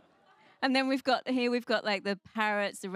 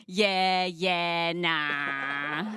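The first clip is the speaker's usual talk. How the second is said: louder broad mock Australian drawl; Australian accent; 4 semitones lower, 5 dB louder, much slower than usual. Comic imitating an Australian brushing someone off, Australian accent. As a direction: drag it out like a lazy Aussie. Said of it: "But an Australian would say".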